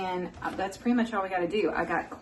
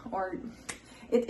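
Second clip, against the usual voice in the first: ordinary talk, nothing marked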